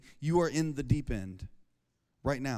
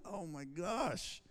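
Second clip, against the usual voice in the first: dismayed oh my gosh; 11 dB quieter, much slower than usual. Retold or imitated. Dad dismayed by school talk.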